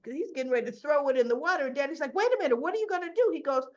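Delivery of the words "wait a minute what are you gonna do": fast, high, loud objection; imitating a panicked younger brother; alarmed protest. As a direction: blurt it out in mock panic. Boy panics as vape gets tossed.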